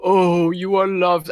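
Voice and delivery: slow, drawn-out saccharine voice; mock syrupy tenderness. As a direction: parody the film's sappy comfort, very slowly. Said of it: "every other phrase was like".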